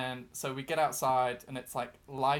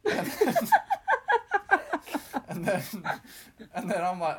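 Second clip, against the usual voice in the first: breaking up with laughter, higher and louder; laughing; 7 semitones higher, 7 dB louder than usual. Real, not acted. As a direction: try to talk through the giggles. Teller cracks up at own story.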